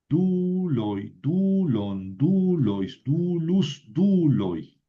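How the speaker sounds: DU-loi, DU-lon, DU-lois, stretched first syllable; measured drill, each "dulus" form stressed on DU; penultimate stress. Shown as a demonstration. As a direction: recite "Duloi, dulon" with a heavy beat on "du". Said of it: "siempre va ahí sobre la penúltima".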